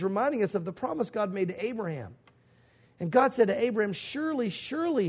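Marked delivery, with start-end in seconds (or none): 3.9-5.1 "Surely, surely" pitched high, each falling; high, insistent hit on each "surely"; emphatic repetition. Acted. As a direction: swoop down from high on both "Surely"s. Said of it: "it's, it's emphatic".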